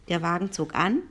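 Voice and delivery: ordinary delivery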